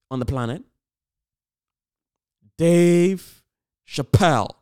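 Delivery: loud, building announcer build-up; big ring-announcer introduction; announcer hype intro. Acted. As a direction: introduce a champ to the arena. Hyping an introduction.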